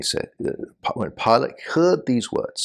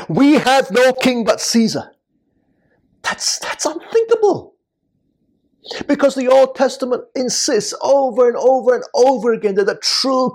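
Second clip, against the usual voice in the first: raised, insistent preacher's voice; impassioned preaching; 7 semitones higher, 4 dB louder than usual. Real, not acted. Preacher to friends on the priests rejecting God's rule, impassioned preaching. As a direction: preach it high and emphatic, driving each point home.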